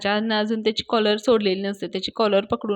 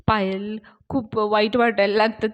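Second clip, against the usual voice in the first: ordinary delivery, nothing marked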